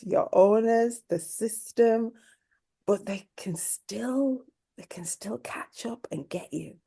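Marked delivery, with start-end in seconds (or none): 2.9-6.8 unvoiced, ominous whisper. Real, not acted. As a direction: drop to a menacing whisper. Dramatic point about being caught.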